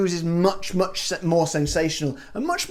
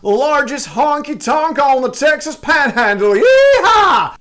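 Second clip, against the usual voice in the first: loud, high, drawn-out Texan American accent; 9 semitones higher, 8 dB louder, slower than usual. Shown as a demonstration. Host shows it's better American, hollered Texan accent. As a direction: holler it slowly in American, building to a whooping yeehaw. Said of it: "say it in an American accent".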